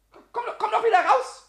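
high, loud, stammered plea to come back; pleading shout. Acted. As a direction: yell into the mousehole. Yelling after the fled mouse. (laut flehendes Rufen)

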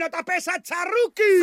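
hoch und laut brüllen; Schrei. Acted. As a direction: den Rückzug herausschreien. Befehl zum Rückzug im Kampf. (hoher, lauter Befehlsschrei)

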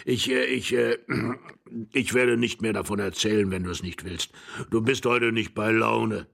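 put-on halting old voice, clearing the throat; flustered old man. Old husband yielding to his bored wife, flustered.

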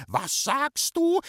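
very high, drawn-out indignant question; outraged scream. A narrator's reading. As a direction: shriek in disbelief. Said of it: "hat geschrien". (empört gekreischt, hoch und gedehnt)

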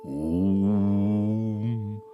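a long, even om; meditative om. A narrator's reading. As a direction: hum a slow, steady om. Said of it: "Und da machte Tete ebenfalls".

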